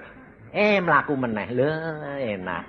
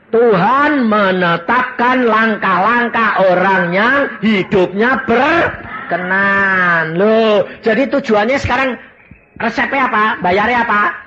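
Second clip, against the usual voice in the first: higher, louder, slow sermon voice; full-force preaching; 8 semitones higher, 6 dB louder than usual. Acted. Preacher quoting Psalm 37 to his flock, loud and slow. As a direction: proclaim the psalm to the congregation.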